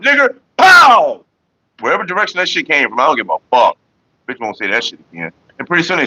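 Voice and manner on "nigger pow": high, loud, slow shout with a pow; mock gunshot shout. Retelling answering the slur with a shot, loud slow shout. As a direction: yell it and fire back. Retold firing back.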